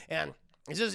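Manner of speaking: ordinary delivery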